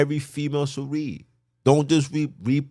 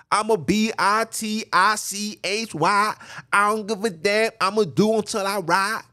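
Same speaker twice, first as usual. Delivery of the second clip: a hint of a brash woman, higher and slowed; mocking a brash woman; 9 semitones higher than usual. Preacher imitating women who boast, lightly mocking brash voice. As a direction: lightly voice her as brash and defiant. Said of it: "They'll tell you".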